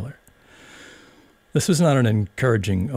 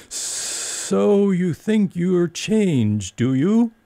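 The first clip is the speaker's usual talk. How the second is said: drawling caterpillar; 12 semitones higher, 3 dB louder, much slower than usual. A narrator's reading. Caterpillar, very slow.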